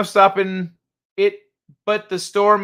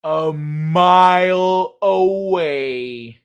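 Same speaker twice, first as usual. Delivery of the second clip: very slow, stretched-out words; dismayed drawl; 3 dB louder, much slower than usual. Real, not acted. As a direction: draw every word out in disbelief. Dismayed at the near miss.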